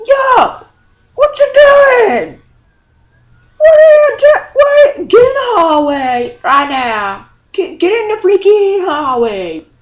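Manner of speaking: high, full-voiced outraged shout; angry teacher yelling. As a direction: imitate a teacher yelling at troublemakers, easing slightly at the end. Teacher bursts in on a fight.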